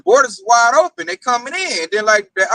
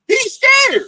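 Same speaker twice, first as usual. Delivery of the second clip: mocking shout. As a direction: shout it out to rub it in. Mocking the soldier to coworker.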